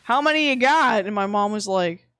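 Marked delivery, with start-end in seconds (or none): 0.0-1.0 lightly voiced pushy customer; louder, raised voice mimicking a buyer; imitating a blunt stranger's question; imitated buyer. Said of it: "one place was just like".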